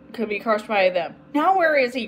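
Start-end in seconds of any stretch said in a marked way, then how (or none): 1.3-2.1 higher, anxious question; mild cry. A narrator's reading. Agnes losing sight of Jip, mildly crying out. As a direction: call out in worry. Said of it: "cried Agnes".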